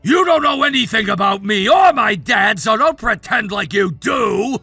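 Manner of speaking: low, forceful shout; defensive anger. Acted. Son rejecting talk about his dad, defensive anger. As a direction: shut them down with a wounded yell.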